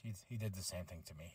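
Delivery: very low, hushed quick aside; Rocky. Retold or imitated. Host imitates wrestler confiding to the others, low hushed aside. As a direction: drop it to a low, quiet confession.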